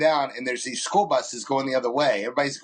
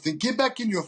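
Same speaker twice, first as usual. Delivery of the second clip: mock angry order; 7 semitones higher than usual. Retold man ordering women away.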